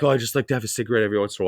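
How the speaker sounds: quick, mocking quote of a casual smoker; fast mocking imitation of a cigarette smoker. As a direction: rattle it off like a dismissive excuse. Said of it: "And they're like".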